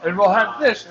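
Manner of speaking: high mocking parent impression. Son mocking parent's stalling answer.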